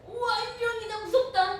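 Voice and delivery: high, loud cry of fright; scared disciples. Acted. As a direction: shriek at the ghost on the water. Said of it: "다 두려워했을 때에".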